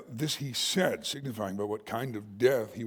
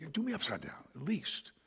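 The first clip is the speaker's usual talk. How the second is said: ordinary talk, nothing marked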